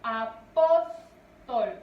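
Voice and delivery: "Apóstol" slowly split, PÓS loud and long; a... PÓS... tol, punching the middle of "Apóstol"; stressed middle syllable. Shown as a demonstration. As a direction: hammer PÓS in "Apóstol", then fall away. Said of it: "su mayor fuerza de voz".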